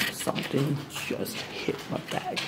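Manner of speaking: hushed, startled whisper. Real, not acted. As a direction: breathe it out in alarm. Startled, whispers then repeats aloud.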